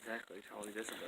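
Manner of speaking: hushed fast whisper. Real, not acted. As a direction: keep the voice down low. Whispering near the bird.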